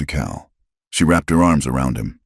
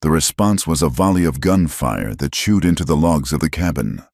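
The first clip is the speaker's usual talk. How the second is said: ordinary delivery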